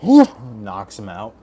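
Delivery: loud laughing punchline. Real, not acted.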